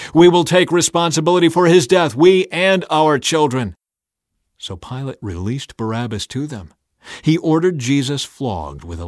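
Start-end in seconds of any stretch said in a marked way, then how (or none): 0.1-3.8 loud, high crowd yell; yelling back. A narrator's reading. People answering Pilate, yelled back. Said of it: "all the people yelled back".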